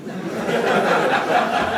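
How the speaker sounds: big loud laugh; hearty real laughing; loud laughter. Real, not acted. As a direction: roar with laughter at your own punchline. Big laugh at own joke.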